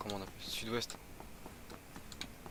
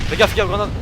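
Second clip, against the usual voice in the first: sudden loud high warning; grenade warning yell; 8 semitones higher, 10 dB louder than usual. Real, not acted. Warns team of grenade.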